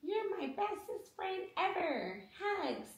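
slow, high, soft doll voice; Barbie. Pretend. Mom's doll hugging child's doll, high sweet voice. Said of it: "Mommy can't do that voice".

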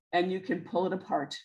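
ordinary talk, nothing marked

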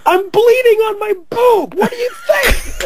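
very high, indignant yell; retold outraged shout. Answering everybody after the thistle fight, high indignant shout. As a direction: shriek it back in mock outrage.

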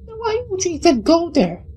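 very high squeaky disbelief; exasperated squeal. Real, not acted. Player questioning the heroine's choice, exasperated high squeal.

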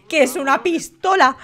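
usual speaking voice

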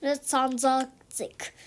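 Mistrz język, each syllable drawn out very slowly like the app; careful, slow mimic of the Polish text-to-speech; slow copy of app. Repeats the app's Polish output.

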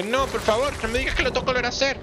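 high, fast panicked whine; mock panic. Gamer imitating his own panic at replaying a level, high and fast. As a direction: replay the moment of dread, hammed up. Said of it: "Me asusté por un segundo y que".

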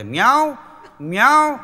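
high, loud meowing like a cat ringtone. Retold or imitated. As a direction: imitate a phone meowing. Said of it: "આ બિલાડીની ring રાખી છે ને". (બિલાડીની ringtone જેવું ઊંચું મ્યાઉ)

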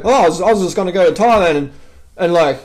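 mock-whine the disappointed tourist; mocking a complainer. Vlogger mocking a disappointed Thailand visitor, whiny. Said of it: "for someone to go".

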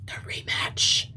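slow, ominous whisper of rematch; dramatic whisper. Acted. Streamer whispers the upcoming rematch to chat, ominous. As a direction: breathe it like a threat.

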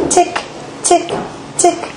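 high, spaced-out tick noises; crisp little high ticks with gaps between; hammer ticking. A narrator's reading. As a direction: tick like a tiny hammer on leather. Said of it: "went the leprechaun's hammer".

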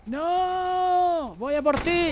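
very high, loud, long-held wail; dramatic rescue cry. Real, not acted. Player goes to save a fallen teammate, high wailing shout.

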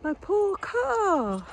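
high, very slow wail; mock lament. Real, not acted. Owner lamenting her poor car, high slow mock wail. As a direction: mourn the car theatrically.

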